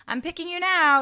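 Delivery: high, loud, pushy imitation; hammed-up insistence, pitched right up; mock eager plea. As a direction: blurt it high and loud, mimicking someone. Acting out an awkward winning-back line.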